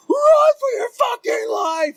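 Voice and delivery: very high, loud drawn-out yell; screamed warning. Retold or imitated. Friend yelling to flee a sprinting shadow, retold high scream. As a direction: scream it slowly as if for dear life.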